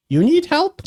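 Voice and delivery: very high little fairy voice; high squeaky pixie offering help; squeaky female pixie. Acted. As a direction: ask it in a tiny, high-pitched squeak. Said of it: "it's a female voice".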